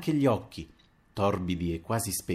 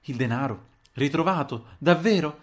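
incredulous questions climbing higher each time; astonished; 6 semitones higher, 4 dB louder than usual. A narrator's reading. Landlady hears the money is found, astonished. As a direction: ask in growing disbelief. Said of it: "mi guardò stupita".

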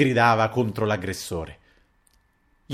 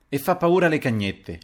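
higher, louder contemptuous jab; resolute scorn; 6 semitones higher, 4 dB louder than usual. A narrator's reading. Narrator answers the painter back, resolute and scornful. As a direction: throw it out with contempt. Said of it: "risoluto e sprezzante".

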